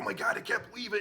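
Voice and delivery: high, loud, laughing outburst of disbelief; excited yell. Retold or imitated. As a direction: shout it out in delighted disbelief, laughing. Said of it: "so excited about it".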